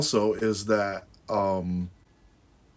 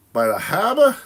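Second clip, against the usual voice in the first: put-on Rhode Island accent pushed higher and louder, harbor as hahbah; 9 semitones higher, 6 dB louder than usual. Teasing the viewer again, louder hammed-up Rhode Island accent. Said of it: "I was talking like that one day".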